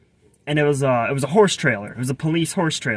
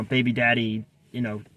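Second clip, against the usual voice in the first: ordinary delivery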